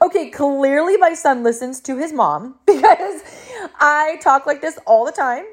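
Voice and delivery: chuckling mom marvelling at her son; laughing through it. Retold or imitated. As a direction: laugh while telling how proud you are. Mom amused by son's words.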